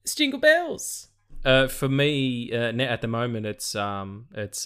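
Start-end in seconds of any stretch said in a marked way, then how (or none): 0.0-1.1 silly high voice. Pretend. Joking about jingle bells question.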